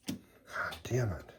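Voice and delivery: slow, breathy whispered curse; frustrated whisper. Real, not acted. Worked up over the fight, he whispers a frustrated curse. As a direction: mutter it under your breath, fed up. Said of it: "Sorry, I'm worked up".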